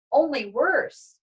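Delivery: plain reading voice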